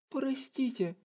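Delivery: high, small frightened sorry; scared apology. Acted. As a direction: flinch and apologize.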